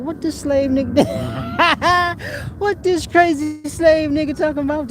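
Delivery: hammed-up disbelief at a strange comment; mock incredulous friend. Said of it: "that Chicken George talk where you like".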